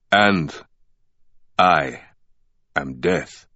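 low, slow booming death voice. A narrator's reading. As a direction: boom it out like death itself. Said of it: "the voice boomed".

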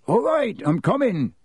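high-pitched, put-upon Hagrid; impatient. A narrator's reading. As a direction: call out impatiently, pitched right up. Hagrid answers impatiently on leaving.

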